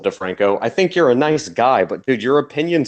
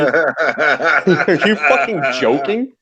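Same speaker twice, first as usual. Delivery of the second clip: laughing disbelief; 5 semitones higher than usual. Real, not acted. Outraged disbelief at article.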